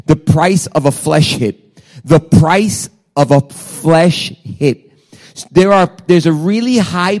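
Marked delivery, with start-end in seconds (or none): 2.0-4.9 drawn out, word by weighty word; slow emphatic repeat. Real, not acted. Preacher repeating the sermon title to the congregation, slow and emphatic. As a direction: spell out the title slowly, leaving space between words.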